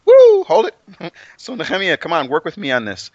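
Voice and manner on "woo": loud high whoop to stop the reading; sudden loud high whoop; excited whoop. Real, not acted. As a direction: let out a big excited woo. Calls a halt, excited.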